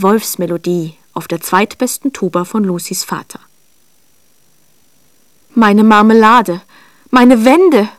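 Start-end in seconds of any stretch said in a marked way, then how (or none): 5.5-7.9 loud, slow wail over her jam and walls; mother cries out in dismay; mother's outcry. A narrator's reading. Mother upset over jammed walls, loud dismayed cry. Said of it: "sagte Lucys Mutter".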